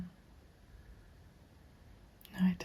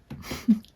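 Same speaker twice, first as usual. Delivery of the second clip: brief whispery chuckle; 5 dB louder than usual. Acted. Interviewer agreeing with her client, short hushed chuckle. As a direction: give a short, hushed laugh.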